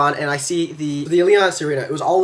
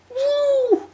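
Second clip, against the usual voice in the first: squealing whoop. Retold or imitated. Mimicking ride thrill with laugh.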